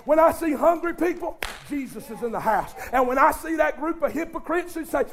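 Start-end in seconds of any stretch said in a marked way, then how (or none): none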